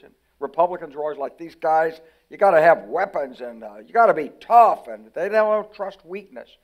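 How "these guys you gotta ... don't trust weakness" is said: louder, pushy hawk voice, slowing at the end; lightly hammed-up Republican bluster; mock tough-guy Republican. Mocking the Cold War Republicans, louder and pushy.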